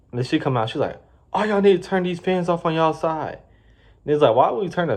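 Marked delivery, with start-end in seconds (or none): 1.3-3.4 high, put-on bossy voice; bossy manager. Manager ordering workers to turn fans off, mock bossy. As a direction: mimic the boss's power-trip order.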